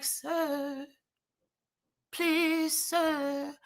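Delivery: high, soft, sweetly pleading; mock angelic plea. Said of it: "even if I angelically did that".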